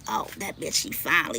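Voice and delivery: hushed imitation of a gloating man; mocking rival's secret glee. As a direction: whisper it like he's pleased she spoke. Mimicking rival's reaction.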